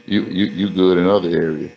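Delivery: ordinary talk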